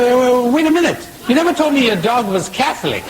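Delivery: a sudden greedy change of heart, laughing through it; eager backpedal. Acted. Priest calling the man back, suddenly eager and high.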